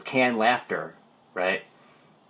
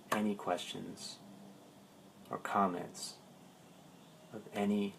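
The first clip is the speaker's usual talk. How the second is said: meditative teaching. Real, not acted. The coach guiding his live viewers toward presence, meditative.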